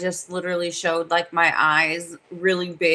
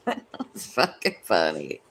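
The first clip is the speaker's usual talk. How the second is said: laughing; 12 semitones lower, 6 dB quieter than usual. Real, not acted. Laughing at her own story.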